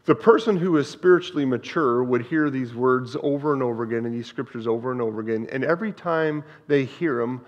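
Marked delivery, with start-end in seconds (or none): none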